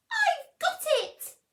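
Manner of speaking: high, loud squeaky mouse voice; a tiny mouse bursting with an idea; mouse's eureka. A narrator's reading. Little mouse to himself in bed, high and excited. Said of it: "he said".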